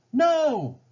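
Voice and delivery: high loud desperate shout; cry out. A narrator's reading. Smith shouting as he blocks the bullet. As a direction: leap in and yell. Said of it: "John Smith cried".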